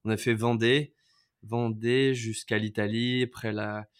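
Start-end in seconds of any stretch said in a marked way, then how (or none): none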